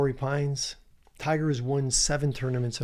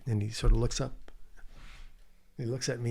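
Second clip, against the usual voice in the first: normal talking voice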